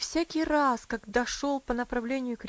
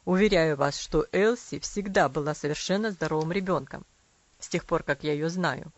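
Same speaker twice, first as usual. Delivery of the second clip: lower father voice; 6 semitones lower, 5 dB louder than usual. A narrator's reading.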